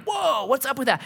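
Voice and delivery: retold surprise, voice jumping high; startled. Acted startled reaction in story.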